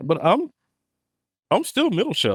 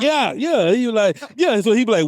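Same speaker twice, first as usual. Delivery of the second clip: ordinary delivery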